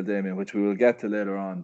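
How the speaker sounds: ordinary talk, nothing marked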